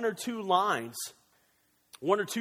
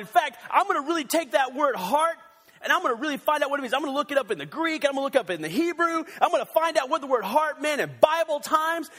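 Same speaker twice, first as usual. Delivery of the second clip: higher, louder, fast overeager rush; mock overzealous; 8 semitones higher, 4 dB louder than usual. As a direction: ham up a fanatic who gets carried away. Mock overzealous card study.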